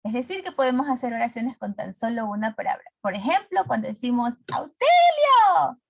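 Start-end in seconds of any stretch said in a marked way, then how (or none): 4.5-5.8 high, loud and slow cry for help; desperate yell for help; exclaimed cry for help. Shown as a demonstration. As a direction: call out for help at full force. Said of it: "se identifican justamente por esta intensidad".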